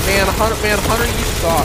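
raging gamer. Real, not acted. Raging at teammate Hunter.

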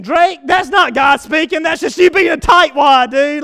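high, loud mock objection from a listener; mock skeptic. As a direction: shout the doubter's retort back at yourself. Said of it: "And you're thinking".

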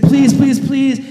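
high, loud pleading pleases; desperate begging. Retold or imitated. Debtor begging the king, high and loud. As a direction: throw yourself on his mercy. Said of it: "He's like".

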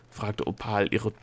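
ordinary delivery

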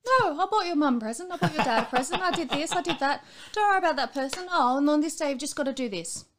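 high, loud, breathless cheer; manic cheery rush. Retold or imitated. To her worried husband, high loud manic cheer. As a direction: rattle it off like it's all sorted.

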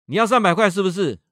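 高声怒吼质问; 把质问整句吼出来; 愤怒大吼. Retold or imitated. Father yells at crying son. (父亲对哭着的儿子高声怒吼质问)

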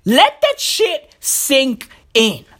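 "Let that shit sink in" slowly, word by word; heavy, stretched-out delivery for effect; slow, deliberate emphasis. Acted. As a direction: space out each word with pauses before "sink" and "in". Slowed repeat for emphasis.

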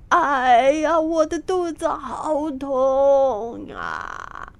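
a pained, drawn-out wail, slowed right down; crying out in pain. A narrator's reading. Monk clutching his belly, wailing in pain. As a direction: groan it as the cramp hits. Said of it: "抱着肚子大喊道".

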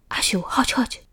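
whispered, secretive teacher; whispering. A narrator's reading. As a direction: hush so nobody hears. Teacher behind closed door.

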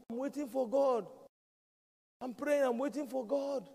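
high, loud imitation of a stubborn man; insistent cry. Flood victim to the speedboat, acted insistent cry. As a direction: call it out, pitched well up. Stranded man refusing rescue, retold.

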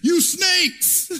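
bearded preacher yelling at religious leaders; furious shout. Acted. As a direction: hurl the insult at the crowd. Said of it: "he looks up and he says".

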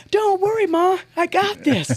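very high, loud imitation of a cocky daughter; confident kid. Daughter brushes off mom's worry about the sign, high cocky imitation. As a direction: pipe it up as an unworried kid. Daughter cockily reassures worried mom.

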